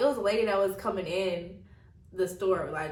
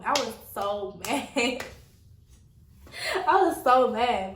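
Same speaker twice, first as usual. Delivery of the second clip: drawn-out slow words broken by laughter; laughing exasperation; 3 semitones higher, 5 dB louder, slower than usual. Real, not acted. Woman regretting the good food she threw out, laughing exasperation. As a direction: laugh through the complaint, leaning on each word. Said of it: "I was mad as hell".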